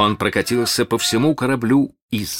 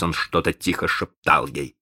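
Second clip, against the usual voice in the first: usual speaking voice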